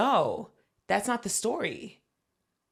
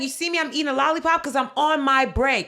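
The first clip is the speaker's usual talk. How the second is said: higher and louder, snapping back; fed-up clerk; 6 semitones higher, 6 dB louder than usual. Retold or imitated. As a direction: fire it back at them. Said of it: "She's like".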